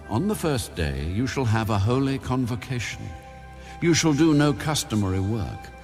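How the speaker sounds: ordinary delivery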